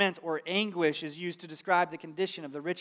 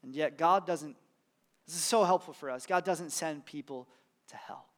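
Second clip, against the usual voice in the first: usual speaking voice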